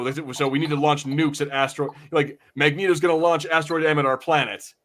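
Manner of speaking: usual speaking voice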